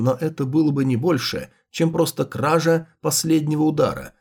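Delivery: plain reading voice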